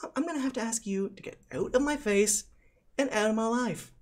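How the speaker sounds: put-on high-pitched bossy teen girl; put-on high schoolgirl voice; haughty prom-queen schoolgirl.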